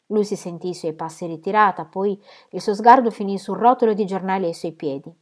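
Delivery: usual speaking voice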